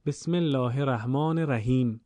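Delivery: "الرحمن الرحیم" with a short single r in each word; tashdid dropped. Shown as a demonstration. Teacher imitating a faulty reading, single short ر in "الرحمن الرحیم". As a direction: skim the ر in "الرحمن" and "الرحیم", undoubled. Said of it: "این غلطه".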